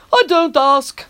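yawned out; yawning. Acted. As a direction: say it through a yawn. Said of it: "the driver yawned".

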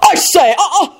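high, stammering pompous bluster; flustered bluster. A narrator's reading. Stuffy diner put off his food, blustering. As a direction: splutter indignantly, pitched high. Pompous Richard protests over the gore.

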